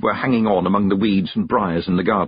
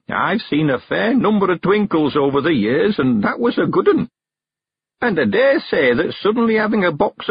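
put-on high old countryman voice; old man sending the girl on an errand, high and eager; Mister Weevil; 9 semitones higher, 3 dB louder than usual. A narrator's reading. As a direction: lift into a high, chatty old man's voice.

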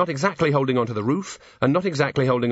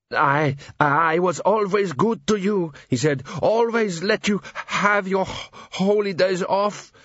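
slow, higher, stammering plea; gibbering terror; 8 semitones higher, slower than usual. A narrator's reading. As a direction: beg in terror. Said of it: "Sock gibbered".

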